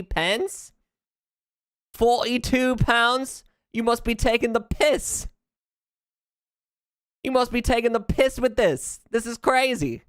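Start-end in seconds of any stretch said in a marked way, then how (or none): 1.9-10.0 high, incredulous mock British, drifting Australian; British accent slipping Australian. Streamer balking at a sandwich price, in mock British accent. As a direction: put on an outraged British accent that wanders toward Australian. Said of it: "it got a little Australian there at the end".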